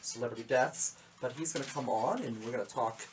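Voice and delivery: ordinary talk, nothing marked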